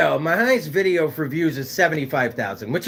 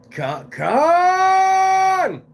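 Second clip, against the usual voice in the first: calling out; 11 semitones higher than usual. Real, not acted. Calling out viewer name.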